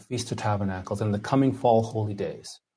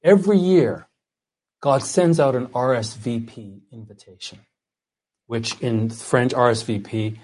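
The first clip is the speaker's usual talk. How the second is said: ordinary talk, nothing marked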